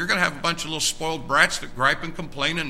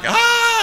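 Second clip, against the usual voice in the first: high, loud mock shout of excitement; imitated crowd cry; 8 dB louder than usual. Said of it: "I heard like".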